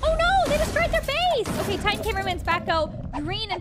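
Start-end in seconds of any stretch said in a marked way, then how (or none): none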